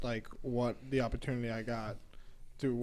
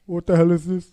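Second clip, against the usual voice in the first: put-on Jamaican accent; 4 semitones higher, 15 dB louder than usual. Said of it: "In his Jamaican accent".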